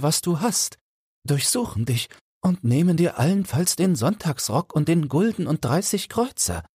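high pitched fretful Felix blaming his friend; fearful young goldsmith. Acted. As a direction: plead it, scared of the dark woods.

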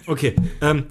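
ordinary talk, nothing marked

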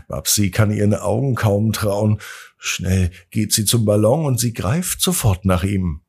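plain reading voice

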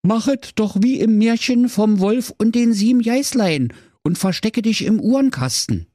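high robotic helper voice; voice assistant voice. A narrator's reading. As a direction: speak like a smart speaker. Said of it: "Und Alexa sprach".

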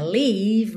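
"leave" with a long, wide EE; long full vowel. Shown as a demonstration. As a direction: stretch "leave" so it is not "live". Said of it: "mais horizontalzinha". ("leave" com o I longo e cheio)

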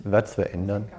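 normal talking voice

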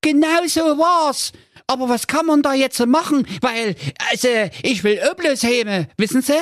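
put-on high-pitched fretful young prince; a pampered prince in a panic; whiny high prince. Prince pleads. (hohe, weinerliche Prinzenstimme)

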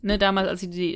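ordinary talk

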